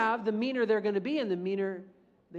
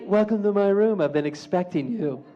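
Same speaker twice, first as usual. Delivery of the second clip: a touch of a creepy villain; creepy villain greeting; 6 dB louder than usual. Pretend. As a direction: greet him like a movie villain. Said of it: "you could say".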